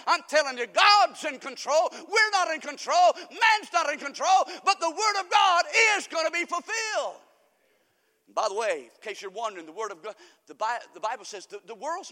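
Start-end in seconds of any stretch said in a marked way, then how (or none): 0.1-7.2 loud, high, insistent declaration; rising, emphatic preacher's cadence; full-force preaching. Real, not acted. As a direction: hammer each clause home from the pulpit.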